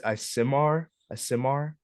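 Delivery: "a Simar" sounded out as ah-SIM-ar, rising; unsure pronunciation. Real, not acted. Game master stuck on an unfamiliar race name, unsure pronunciation. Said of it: "don't even know how to pronounce".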